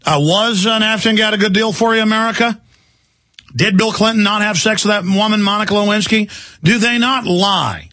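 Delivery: high-pitched, lightly mocking questions; mock questioning. Host challenging listeners about politicians' lies, mock questioning. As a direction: pitch it up as pointed rhetorical questions.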